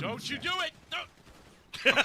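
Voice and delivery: high, loud don't you do it; frantic high yell at the replay; yelling at the screen. Real, not acted. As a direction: shout it at the screen in dread. Yelling at replayed game footage.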